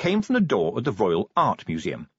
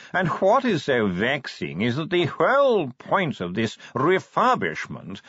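a fussy, high-class curator drawling slowly, words half yawned; a preoccupied chicken of a posh gentleman, dragging each word; posh yawning curator; 5 semitones higher, slower than usual. Acted. Curator voiced in story.

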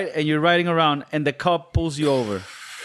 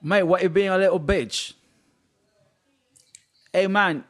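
higher-pitched mock London accent; 4 semitones higher than usual. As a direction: put on a comic London lilt. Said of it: "say it with an accent".

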